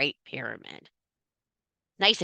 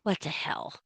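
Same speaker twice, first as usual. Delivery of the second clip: mock exasperated ancestors; 8 dB quieter than usual. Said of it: "they're like".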